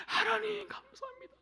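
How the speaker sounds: high, tearful thanks to God. Retold or imitated. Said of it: "막 울면서". (울먹이며 높은 목소리로 감사)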